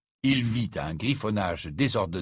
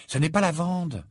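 higher-pitched weary reassurance; 8 semitones higher, 4 dB louder than usual. A narrator's reading. Hermione reassuring Ron about the girl, weary. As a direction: reassure with tired patience. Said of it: "d'un ton las".

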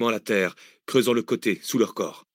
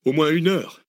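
Arsène cuts in, pitch raised; cutting in; 7 semitones higher, 3 dB louder than usual. Acted. Arsène cutting in on Cécile, quick interjection. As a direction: jump in before she finishes. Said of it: "coupa Arsène".

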